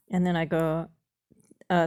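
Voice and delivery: ordinary talk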